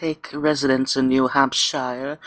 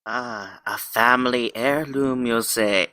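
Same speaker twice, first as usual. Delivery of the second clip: ordinary talk, nothing marked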